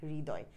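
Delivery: হৃদয় softly, with a breathed h before ri; hri-doy for হৃদয়; demonstrated pronunciation. Shown as a demonstration. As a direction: let the h of হৃদয় out first, then ridoy. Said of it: "আমরা এরকম বলি".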